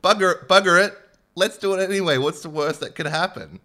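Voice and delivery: loud, high careless boss; reckless company voice. Retold or imitated. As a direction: shout it off carelessly. Company acting recklessly, voiced quote.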